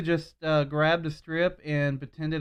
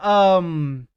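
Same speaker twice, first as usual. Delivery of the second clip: loud, higher improvised excuse; stalling liar; 4 semitones higher, 10 dB louder, slower than usual. Retold or imitated. Replaying the fib to a coworker, loud stalling. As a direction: act out inventing a lie aloud. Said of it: "I'm like".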